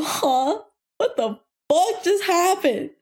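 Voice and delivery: high, exaggerated disbelief; hammed-up confused outburst; baffled reaction. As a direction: blurt it out in mock bewilderment. Acting out own baffled reaction.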